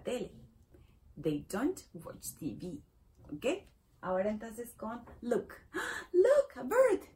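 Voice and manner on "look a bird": gasp, then a high loud delighted call; gasping excitement. Shown as a demonstration. Teacher acts spotting a bird for pupils, thrilled call.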